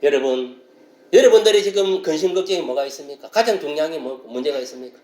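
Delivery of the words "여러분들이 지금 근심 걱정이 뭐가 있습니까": ordinary talk, nothing marked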